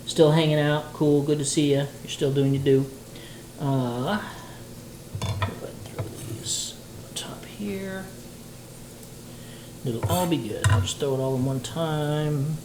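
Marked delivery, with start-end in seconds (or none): 5.2-8.0 whisper. Real, not acted. Muttering to herself while cooking.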